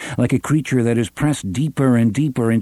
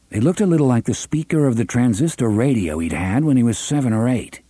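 ordinary delivery, nothing marked

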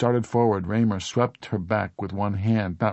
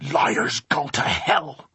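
slow, high, strained cry; angry shout; 5 semitones higher, much slower than usual. A narrator's reading. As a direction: shout it slowly like a curse. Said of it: "Mary Doolin shouted".

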